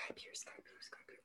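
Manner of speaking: whispering the names to himself. Real, not acted. Co-host whispers to himself while searching his memory, whispering. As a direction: breathe it out under his breath, puzzling.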